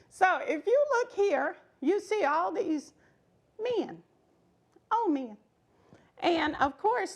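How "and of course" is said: plain reading voice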